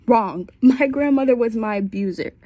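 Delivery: ordinary talk, nothing marked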